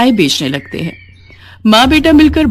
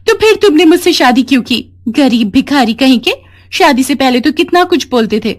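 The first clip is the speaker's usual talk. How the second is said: angry wife; 5 semitones higher than usual. A narrator's reading. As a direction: tear into him and storm off. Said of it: "रोजी गुस्सा हो जाती है और कहती है". (गुस्से में ऊँची आवाज़ में झिड़कती पत्नी)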